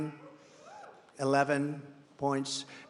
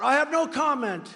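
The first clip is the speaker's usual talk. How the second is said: higher, louder mock shout of the answer; 8 semitones higher, 5 dB louder than usual. DeSantis answering reporters, a mock shout. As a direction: bark out no comment as a retold shout. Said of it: "He shouts out".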